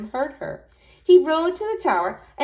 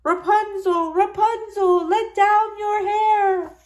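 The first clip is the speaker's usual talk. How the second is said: prince's high, slow mock witch call; imitating the witch; 12 semitones higher, 4 dB louder, slower than usual. A narrator's reading. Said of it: "He called softly, trying to imitate the witch".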